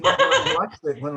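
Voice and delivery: amused, laughing as he speaks. Real, not acted. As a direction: laugh it out while remembering. Laughing while recalling the show.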